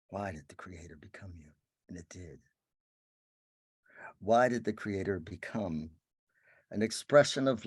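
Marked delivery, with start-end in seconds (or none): 0.1-2.4 breathy whispered question, hushed and intimate. Real, not acted. Spiritual teacher to listeners, a whispered question.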